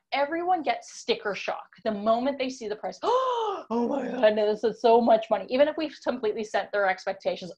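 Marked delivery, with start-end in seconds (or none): 3.0-3.6 a sharp, high mock gasp of shock. Client sees the price. 3.7-5.5 mock sticker shock. Client's sticker shock acted.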